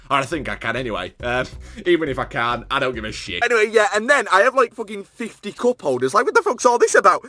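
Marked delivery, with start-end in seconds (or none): 3.4-7.3 high, loud, mounting exasperation; worked-up shouted rant; exasperated rant. Acted. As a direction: rant it louder and higher as it builds. Mock rant about cup holders.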